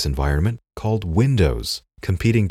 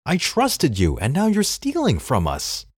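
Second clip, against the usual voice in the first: angry, climbing higher in pitch; voice rising higher; 8 semitones higher than usual. Acted. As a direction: let the pitch climb with each word. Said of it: "raising his voice higher and higher".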